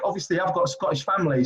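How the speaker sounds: usual speaking voice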